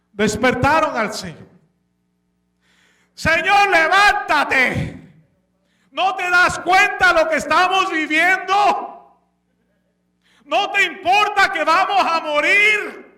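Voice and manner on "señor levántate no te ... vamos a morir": higher, urgent begging; pleading disciples. Acted. Disciples waking Jesus in the storm, higher panicked pleading. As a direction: plead with him to wake up and act.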